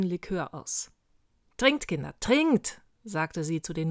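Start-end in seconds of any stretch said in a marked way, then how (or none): 1.6-2.8 loud urging, rising high on the last word; urging head witch. A narrator's reading. Oberhexe hands novices green liqueur, urging them loudly. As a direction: press the drink on them, louder and higher.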